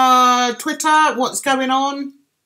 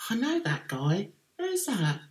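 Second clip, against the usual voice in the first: said softly, as if to oneself; retold thought, dropped well below normal volume; hushed private wondering; quiet inner thought; 3 semitones lower, 11 dB quieter than usual. Retelling her own puzzled thought.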